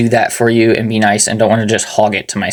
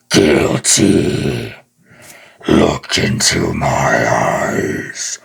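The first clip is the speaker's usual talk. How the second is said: deep, slow demonic growl; Ghost Rider; 9 semitones lower, much slower than usual. Shown as a demonstration. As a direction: judge them like a vengeful spirit. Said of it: "there's my Ghost Rider impression".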